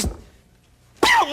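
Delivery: drawn-out, punched "boom"; explosive sound effect. Retold or imitated. Monkey bolts out the front door, explosive effect.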